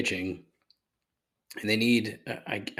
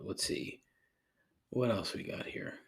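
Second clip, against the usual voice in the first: muttered whisper; 9 dB quieter than usual. Real, not acted. Muttering to self reading list.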